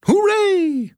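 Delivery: high, bright cheer of joy; excited cheer. Acted. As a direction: shout it with glee. Cheery ad celebrates cabins.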